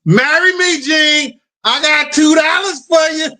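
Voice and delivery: high mock woman voice; squeaky pleading proposal; lightly voiced woman asking for marriage; quoting her. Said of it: "She said".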